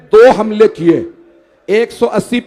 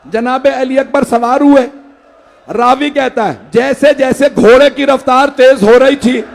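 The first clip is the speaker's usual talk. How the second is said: mourning recitation; 3 semitones higher, 3 dB louder than usual. Acted. Majlis lament narration.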